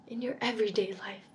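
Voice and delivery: soft breathy echo of the invitation; "in your everyday life" whispered after the spoken line. Shown as a demonstration.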